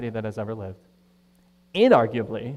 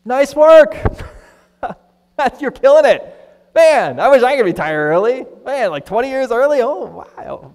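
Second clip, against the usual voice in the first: much higher and louder, envious cheering; gushing friend; 11 semitones higher, 14 dB louder than usual. Retold or imitated. Preacher voicing an envious friend: gushing and high. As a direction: hype up a friend who retired early. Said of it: "What would you say if you had a friend like that?".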